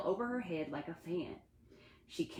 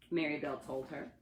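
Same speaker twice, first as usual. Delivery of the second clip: ordinary delivery, nothing marked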